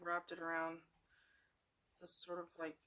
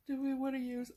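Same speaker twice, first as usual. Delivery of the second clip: yawning; 7 semitones higher, 6 dB louder than usual. Real, not acted. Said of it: "I am sorry for yawning".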